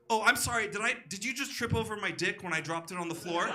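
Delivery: hammed-up arrogant strongman; Zelensky. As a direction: boast grandly, laughing at your own brag.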